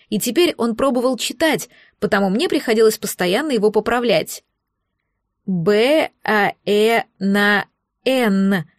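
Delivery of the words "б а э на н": spelling out letters haltingly; baby dragon sounding out. A narrator's reading. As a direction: read letter by letter like a child. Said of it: "прочитал дракончик". (дракончик читает по буквам)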